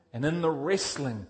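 ordinary talk

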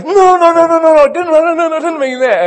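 high, loud, rushing run of no's; a little girl panicking to take it back; frantic child protest. Retold or imitated. Dad voicing his six-year-old daughter, frantic and loud.

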